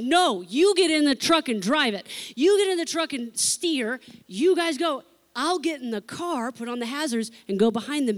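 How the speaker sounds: loud, firm orders over traffic; taking charge. Retold or imitated. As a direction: direct the driver like you're in command. Giving orders at the roadside.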